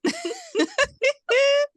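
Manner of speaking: shrieking laugh; high squealing laugh. Real, not acted. Big laugh at joke.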